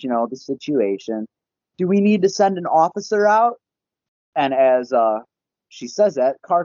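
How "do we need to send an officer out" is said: high-pitched imitation of a dispatcher; police dispatcher. Dispatcher asking the caller about an officer, imitated in high pitch. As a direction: put on a higher operator's voice. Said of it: "to dispatch".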